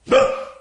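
a short loud fake cry to startle; sudden mock yell. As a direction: bark out a sharp Ah to make him jump. Coach yells to startle student.

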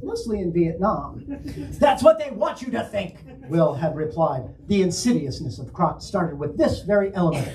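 hammed-up dramatic narration with portentous pauses; William Shatner. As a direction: read it like a hammy starship captain. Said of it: "in a highly Shatner-esque fashion".

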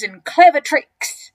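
loud, slow, proud boast; enormous crocodile, smug. Acted. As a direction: savour the words as a schemer.

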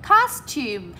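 teacher's model of costume, first syllable stressed. Shown as a demonstration. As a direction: hit COS in costume, let -tume drop. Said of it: "lên giọng ở âm số một và xuống giọng".

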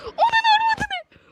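high, loud and drawn out slowly; real shock in the middle of the game; shocked yell. Real, not acted. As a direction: let out a stretched, startled cry.

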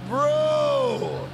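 a loud, fed-up shout of bro; exasperated shout. Real, not acted. Streamer shouts at his nagging game passenger, fed up. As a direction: throw it out in disbelief.

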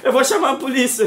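alto e agudo, em protesto; ameaça aos gritos. Acted. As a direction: grite a ameaça no meio da briga. Briga encenada, ameaça. (ameaça exaltada de briga)

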